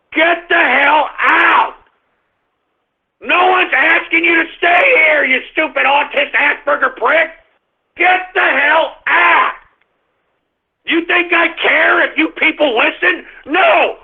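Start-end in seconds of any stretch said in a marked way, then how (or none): 0.1-1.8 slow, drawn-out furious command; angry slow dismissal; slow angry order. Real, not acted. Angry host banishes listeners. 8.0-9.5 slow shouted order; furious slow yell; angry shout. Real, not acted. Angry host banishes listeners.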